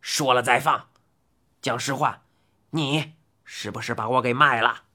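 put-on low, hard old man demand; glaring demand. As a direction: glower and squeeze out the truth. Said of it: "使劲地瞪着他说道".

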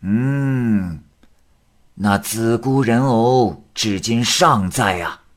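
grave questioning voice, slow; slow stern exorcist. Acted. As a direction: ask it like a knowing sorcerer. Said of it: "李成尧说".

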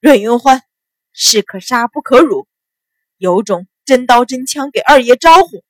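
indignant, forceful outburst; loud, gritted teeth. A narrator's reading. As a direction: call out loudly, daring them. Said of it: "大声道".

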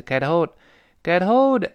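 "get hold" run together so it sounds like "ge-told"; slow "get hold", h almost gone; h nearly dropped. Shown as a demonstration. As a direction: let the t punch through the h in "get hold". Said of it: "又好像没有被打穿了".

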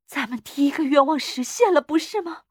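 excited, rising appeal; fervent. A narrator's reading. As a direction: press the point with fervour. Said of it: "老妇人狂热地说".

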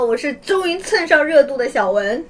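usual speaking voice

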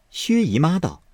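ordinary delivery

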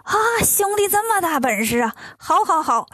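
high-pitched storybook astonishment; wowed by a brother's feat; amazed. A narrator's reading. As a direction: gasp an impressed "啊" and lift the voice high. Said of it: "金角很吃惊啊".

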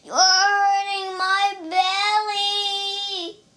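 high, slow wail of pain; shouted. A narrator's reading. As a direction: howl the complaint, stretching every word.

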